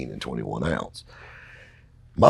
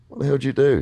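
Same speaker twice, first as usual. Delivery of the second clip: stunned; 11 semitones higher, 5 dB louder, faster than usual. Retold or imitated. Narrator stunned by winnings.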